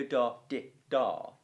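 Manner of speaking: sung out as long short long beats, da, di, da; da di da rhythm. Shown as a demonstration. Teacher chanting the rhythm for students, beat by beat. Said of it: "you never go".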